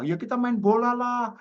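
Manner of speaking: high, drawn-out eager suggestion; imitated childhood chatter. Goat-herding boy invites friend to football, high eager imitation. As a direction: play a child proposing a game.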